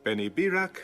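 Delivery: normal talking voice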